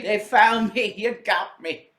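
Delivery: put-on Southern accent. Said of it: "I keep putting a southern accent on him".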